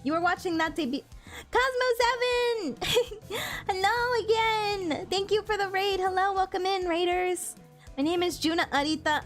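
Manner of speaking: high excited raid greeting; cute anime streamer. Real, not acted. Greeting incoming raiders on stream.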